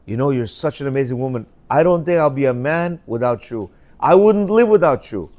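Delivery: soft, quick flattery for a wife; butter her up softly; sweet compliments. Shown as a demonstration. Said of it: "What's the sandwich?".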